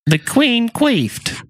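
high, drawn-out headline read; mock tabloid headline. Mocking today's newspaper to co-hosts, mock headline. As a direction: proclaim it slowly like silly news. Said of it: "nowadays it's like".

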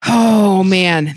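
usual speaking voice

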